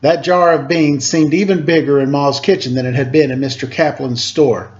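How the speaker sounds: usual speaking voice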